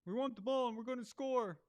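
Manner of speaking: loud, high, brash boast; mocking a confident QB's shouted promise; cocky shouted boast. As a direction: bellow the guarantee like a cocky quarterback. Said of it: "You guys remember when Matt Hasselbeck said that shit?".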